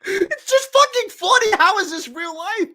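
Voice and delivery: high, laughing cry of disbelief; high giggling disbelief. Real, not acted. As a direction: laugh through the words, overwhelmed.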